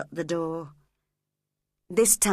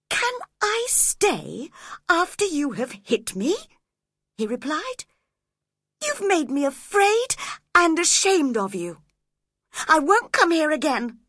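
put-on hurt young man; 9 semitones higher, 4 dB louder than usual. Edgar hurt, refusing Catherine.

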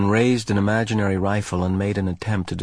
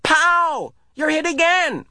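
high, loud mock gunfire yell; 3 dB louder, slower than usual. A narrator's reading. Asher taunting Jonas from behind a tree. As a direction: shout it like a kid playing war. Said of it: "Asher yelled".

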